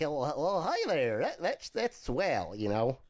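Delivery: a hint of a gee-whiz nerd.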